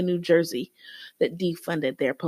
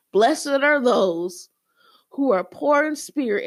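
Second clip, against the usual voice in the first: Jesus quoted; 5 semitones higher than usual. Retold or imitated. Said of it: "what you sound like is if Jesus started off with".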